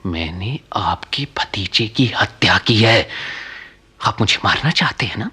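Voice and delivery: resigned confession. Acted.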